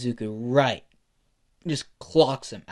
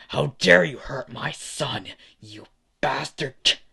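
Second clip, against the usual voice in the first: raised voice, slowing on the insult; enraged father; 2 semitones higher, 4 dB louder than usual. Acted. Hasashi punching Endeavor for hurting his son, enraged. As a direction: roar it, then punch out the insult slowly. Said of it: "Hasashi is filled with rage".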